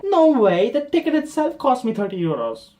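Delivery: high, loud protest; acted outrage at the price; indignant refusal. Indignant at the cost.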